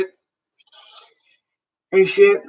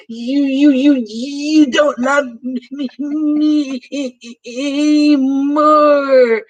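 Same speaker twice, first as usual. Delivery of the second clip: put-on high, slow, sobbing child's voice, stretching every word; imitating daughter bawling; 9 semitones higher, 5 dB louder, much slower than usual. Little daughter wailing at her dad, imitated as a high drawn-out sob. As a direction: mimic a little girl crying that nobody loves her. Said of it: "And just fucking bawling tears".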